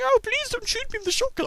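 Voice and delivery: very high squeaky mock plea; cartoonish victim begging not to be shot; pleading enemy. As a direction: whimper out a silly begging voice. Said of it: "and they're going".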